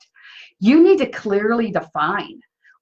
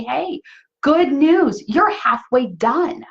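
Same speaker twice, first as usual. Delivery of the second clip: higher, cheery acted encouragement; upbeat announcement; 5 semitones higher, 3 dB louder, slower than usual. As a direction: announce it like welcome news, stretching the end. Voices an encouraging guidepost.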